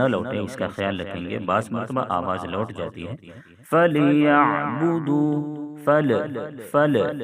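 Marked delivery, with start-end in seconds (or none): 3.7-7.2 فَلْيَعْبُدُوا with the لام of فَل bounced back like qalqala; faulty echoing lam; wrong bouncing lam. Shown as a demonstration. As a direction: show the mistake, a rebound on the لام in فَل. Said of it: "اس طرح سے لام میں آواز لوٹ جاتی ہے".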